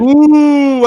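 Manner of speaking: high, loud gleeful ooh; excited ooh. Real, not acted. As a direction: squeal it with glee. Excited exclamation.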